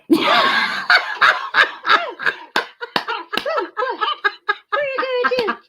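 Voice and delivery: delighted shrieking laugh; high squealing laugh. Real, not acted. Laughing at jumping puppy.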